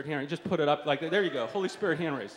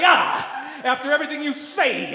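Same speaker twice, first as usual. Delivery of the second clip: loud, high revivalist shout with "ah" after phrases; preacher's punctuating "ahs"; 9 semitones higher, 8 dB louder than usual. Shown as a demonstration. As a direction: demonstrate a fervent preacher, punching "ah" after the words. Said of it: "ahs have to be punctuated after the words".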